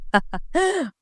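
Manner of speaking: quiet teasing giggle; laugh. Acted. Yusuf laughing at Isra's question, softly amused.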